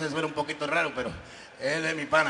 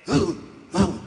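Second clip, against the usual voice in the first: short loud ape grunts; gorilla grunts; 2 semitones higher, 7 dB louder than usual. Acted. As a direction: grunt back at him. Gorilla answers.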